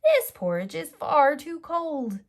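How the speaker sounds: put-on high, loud little-girl whine; child protesting the cold porridge; Goldilocks complaining. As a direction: grumble like a picky child.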